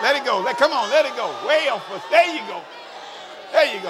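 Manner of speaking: loud, high coaxing calls over the crowd; urging the crying test. Real, not acted. Pastor urging his church to let their crying out, loud and high. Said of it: "I want you to just cry".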